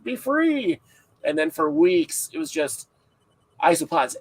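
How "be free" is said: high, swooping farewell call; mock-grand call, releasing them to the wild; retold release cry. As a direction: lift it high and let it sail. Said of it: "was like".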